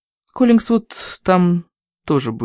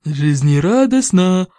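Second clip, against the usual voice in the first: slow, forced through clenched teeth; through gritted teeth; 3 semitones higher, slower than usual. Acted. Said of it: "процедила сквозь стиснутые зубы".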